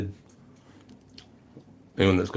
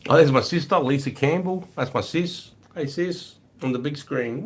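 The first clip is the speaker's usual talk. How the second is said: excited; 7 semitones higher than usual. Real, not acted. Spots his sister in chat.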